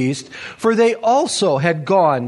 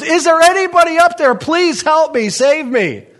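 high, urgent, quick call upward; crying out for help; 8 semitones higher, 4 dB louder than usual. Acted. Man hanging from a cliff branch calls for help, crying out. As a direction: shout up for rescue as if dangling. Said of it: "and he cries out".

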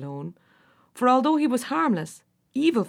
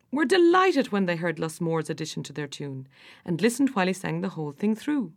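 plain reading voice

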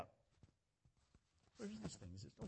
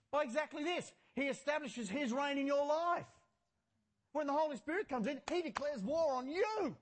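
higher, emphatic pulpit proclamation; forceful preaching; 7 semitones higher, 4 dB louder than usual. Real, not acted. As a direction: declare it boldly to the congregation.

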